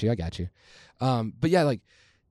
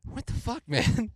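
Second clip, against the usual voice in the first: high, laughing disbelief; incredulous outburst; 9 semitones higher, slower than usual. Retold or imitated. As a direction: blurt it out in shock. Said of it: "and I'm like".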